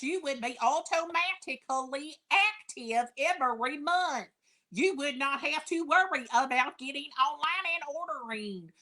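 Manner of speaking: put-on mild West Kentucky accent sales pitch; imitating West Kentucky accent. Imitating an upline pushing Fashion Fix, mild West Kentucky accent. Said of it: "that's my deep West Kentucky accent".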